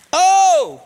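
high, loud "Oh!"; exclaiming like David. Acted. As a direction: burst out with a big exclamation. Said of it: "David said it that way".

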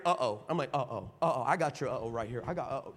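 mock-ominous low voice. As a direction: mutter it low, like trouble coming. Voice heard behind the door.

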